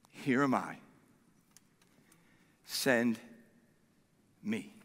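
very low, soft, with long pauses; humble surrender. Acted. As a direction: offer yourself to God in a hushed, halting reply. Isaiah humbly answers God's call.

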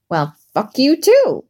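put-on slow drunken slur; drunk slurring. A narrator's reading. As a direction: slur it, tipsy and defiant. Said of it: "she slurred".

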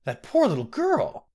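high, loud cry of pity. Acted. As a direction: cry out in sorrow for the girl. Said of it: "cried Gyna".

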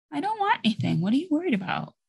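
lightly voiced teenager. Teen child brushing off parent's fuss.

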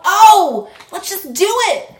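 high, loud eager voice; excited. Retold or imitated. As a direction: play someone thrilled to dive in.

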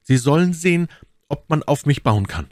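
high-pitched, assured servant; high servant's voice, proudly promising; confident servant. A narrator's reading. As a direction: pitch it up and vow your loyalty.

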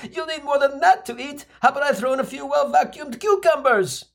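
high, eager feeding mom. Retold or imitated. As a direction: fuss over him, pitched high. Mom adds more food.

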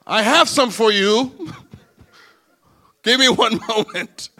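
high, loud, hammed-up choking up; lip trembling. Man offering his prized shoes, mock tearful and slow. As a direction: offer it slowly as if about to cry. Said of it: "when your upper lip starts trembling a little bit".

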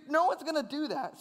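normal talking voice

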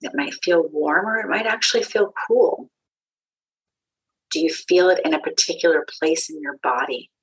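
calm meditation guide with long pauses; unhurried, grounding voice guiding listeners inward; guided meditation. Acted. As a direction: lead a meditation, leaving space between phrases.

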